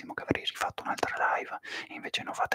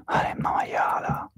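Maremma maiala in a mock Tuscan accent, stretched and punchier; whispered Tuscan curse; 5 dB louder than usual. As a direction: imitate a Tuscan swearing, slowing on maiala. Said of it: "I toscani sono un po' più fini". (imitazione toscana di Maremma maiala, sussurrata ma più forte)

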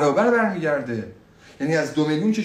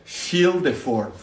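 slow keyword demo; much slower than usual. Shown as a demonstration. Teacher slowly models the keyword phrase for students. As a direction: fill the form, slow and deliberate. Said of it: "فیل رو باید بلد باشی".

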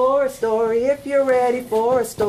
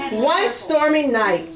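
ordinary delivery

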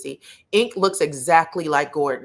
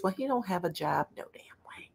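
whispered aside; 10 dB quieter than usual. Real, not acted. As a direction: drop to a voiceless whisper. Muttered aside while reading comments.

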